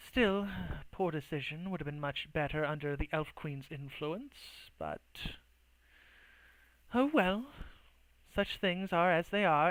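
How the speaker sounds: quiet, resigned elf agent; disapproving elf. Acted. Elf agent regretting the decision, quietly resigned. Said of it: "kind of goes".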